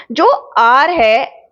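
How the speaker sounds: slow, careful word demo; slow it down to show the sound; slow example word. Shown as a demonstration. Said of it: "आवर है".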